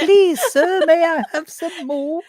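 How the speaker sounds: high, pleading orphan voice; Oliver Twist begging. Pretend.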